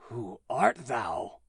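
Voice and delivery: put-on kneeling giant asking the holy child who he is; awestruck. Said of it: "a strange awe fell on him".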